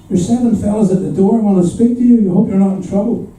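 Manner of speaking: worried aunt. Retold or imitated. Aunt announces seven callers at the door, concerned. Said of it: "my aunt comes in and she says".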